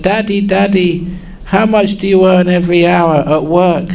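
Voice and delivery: put-on high, eager child voice; little boy pestering. Son pestering his tired father, high eager child voice. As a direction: play a small boy nagging his dad.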